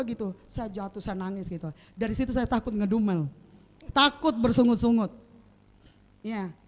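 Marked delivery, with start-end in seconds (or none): none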